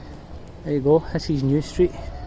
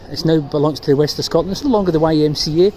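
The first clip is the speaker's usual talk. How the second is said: ordinary talk, nothing marked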